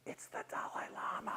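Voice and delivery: appalled whisper; very soft, voiceless aside; hushed realization. Retold or imitated. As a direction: whisper it as the blunder sinks in. Dawning horrified realization, hushed.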